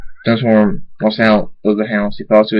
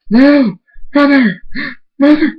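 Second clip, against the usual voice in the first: loud, high-pitched cries of grief; anguished cry; 4 dB louder than usual. Acted. Thunderstorm grieving over his mother, anguished cries.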